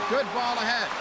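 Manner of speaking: ordinary talk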